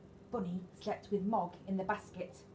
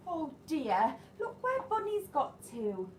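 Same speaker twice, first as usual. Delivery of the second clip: high loud dismayed oh dear; dismayed discovery; 7 semitones higher, 4 dB louder than usual. Acted. Debbie spots Bunny trapped and cries out. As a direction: realize the toy is stuck.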